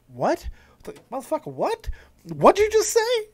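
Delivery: mock incredulous questions, rising into a high squeak; flabbergasted. Host acting out the insulted wrestler's reaction, flabbergasted. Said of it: "flabbergasted. He looked absolutely".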